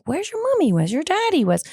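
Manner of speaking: put-on retelling how she asked the toddler, high and coaxing; asking a lost child. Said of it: "And I'm like".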